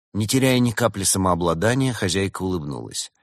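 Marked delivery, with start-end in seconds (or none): none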